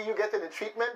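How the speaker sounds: ordinary talk, nothing marked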